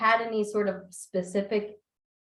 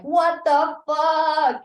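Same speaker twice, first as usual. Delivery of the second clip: long drawn-out, high, loud exclamation; loud, slow, sing-song astonishment; stunned disbelief; 9 semitones higher, 8 dB louder, much slower than usual. Retold or imitated. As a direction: stretch every word out in mock shock. Acting out reaction to the ending.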